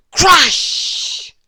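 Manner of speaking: loud, high sound-effect shout; voiced crash. Narrator voices crash noise.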